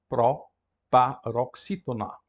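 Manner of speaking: "proparoxítona" slowly broken into syllables. Shown as a demonstration. Teacher introducing a Greek term, slowly syllabified. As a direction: draw out "proparoxítona" piece by piece.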